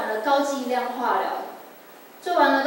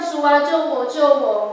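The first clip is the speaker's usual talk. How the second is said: louder, urgent plea for rescue; retold crying out; 3 semitones higher, 7 dB louder than usual. Patient begging the Lord to save her, retold cry. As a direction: call out to God, re-enacting a desperate prayer. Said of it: "我大喊说".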